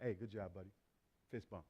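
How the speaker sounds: very low, soft acted praise; understated reassurance, voice dropped right down; low-key encouragement. Father to son messing up at football, muted encouragement.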